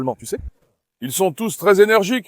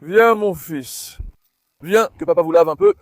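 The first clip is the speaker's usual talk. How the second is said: ordinary talk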